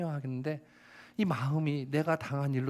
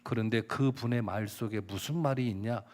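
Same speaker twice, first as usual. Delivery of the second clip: usual speaking voice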